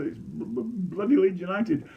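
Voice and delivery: stuck on b, then blurt it out; stammered blurt. Acted. Stammering kid blurts answer.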